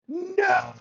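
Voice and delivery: a panicked yelled no, cutting him off; PR team's shout. Retold or imitated. PR team shouting down Connery's remark, a high panicked no. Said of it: "His P R team is going".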